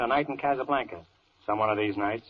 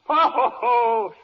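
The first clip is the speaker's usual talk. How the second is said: slow, booming theatrical ho ho ho, much higher and louder; big mock laugh; 10 semitones higher, 6 dB louder, much slower than usual. Judge scoffing at the juror's question, booming mock laugh. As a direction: laugh it off grandly and slowly.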